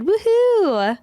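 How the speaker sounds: high, loud cheer; excited cheer. Real, not acted. Host cheering a viewer's happy order, loud excited whoop. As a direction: whoop it up with joy. Cheering comment on live stream.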